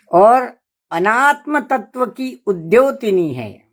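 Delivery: ordinary talk, nothing marked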